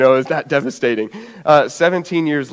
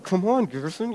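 hushed, higher mock pep talk; strained self-urging; 4 semitones higher, 9 dB quieter than usual. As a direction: urge yourself under your breath, straining. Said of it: "And I'm like".

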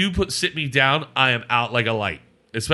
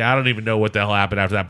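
ordinary talk, nothing marked

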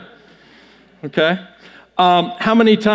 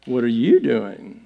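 low, softer question, stress on YOU; lowered, hushed "What are you doing?"; different reading; 8 semitones lower, 5 dB quieter than usual. Shown as a demonstration. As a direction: ask it low and quieter, leaning on "you". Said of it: "Or it's".

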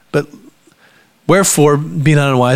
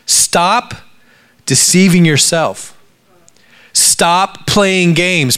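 high, punched "Stop", then a pause; slow, emphatic "Stop playing games"; firm exhortation; 5 semitones higher, much slower than usual. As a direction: urge them, leaning hard on "Stop". Preacher urges congregation in Paul's words.

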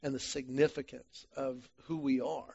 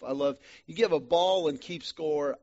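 usual speaking voice

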